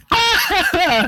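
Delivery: pained laugh then yelp; laugh-cry at the sourness; laughing high yelp. Real, not acted. Sour candy reaction.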